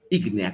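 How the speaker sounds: "ígnea" with the first syllable "Í" stressed. Shown as a demonstration. Teacher spelling the word for students, stressing "ígnea". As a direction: lean on the "Í" of "ígnea". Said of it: "con tilde en la I".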